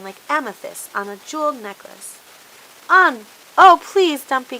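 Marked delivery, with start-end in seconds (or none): 2.9-4.6 high, loud desperate plea; pleading cry. A narrator's reading. Charlie begging the truck to hurry, loud pleading cry. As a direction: beg the truck to go on. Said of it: "cried Charlie".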